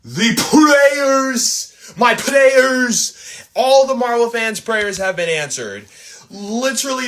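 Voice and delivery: high, thrilled and overjoyed; excited. Real, not acted. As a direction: gush like a dream came true. Overjoyed at the reveal.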